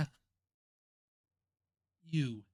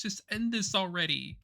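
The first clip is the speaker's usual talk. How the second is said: desperate plea; 7 semitones higher, 5 dB louder than usual. Acted. Karma begging for the end.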